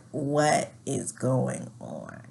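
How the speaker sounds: slow, low, drawn-out disbelief; retold bewilderment. Woman stunned by partner's outburst, slow low bewilderment. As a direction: stretch it out, stunned.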